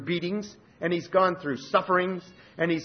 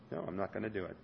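much softer, low, calm divine no; God's quiet refusal; 7 semitones lower, 14 dB quieter, much faster than usual. Acted. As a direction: answer gently but firmly as God. God answering Paul's plea.

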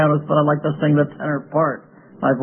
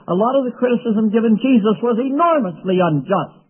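ordinary delivery

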